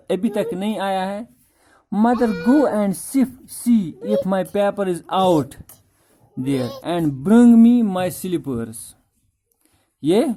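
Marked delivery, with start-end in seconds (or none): 2.0-8.7 slow, deliberate reading of the text; reading the story line by line; slow reading aloud. Shown as a demonstration. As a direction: read each word out slowly for the class. Reads father's line from the text.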